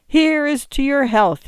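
high, loud forced toast; cried toast. Acted. Ruined young man toasting strangers, loud and high. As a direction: throw the toast out with a forced laugh behind it. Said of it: "he cried".

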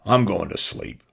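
plain reading voice

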